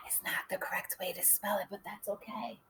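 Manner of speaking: hushed, confiding whisper; very soft whisper; whispered aside. Shown as a demonstration. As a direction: whisper it as a secret to the class. Hushed aside while writing.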